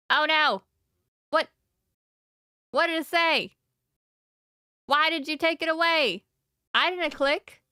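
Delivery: real shock that the message is gone; startled alarm. Real, not acted. Game text vanishes suddenly.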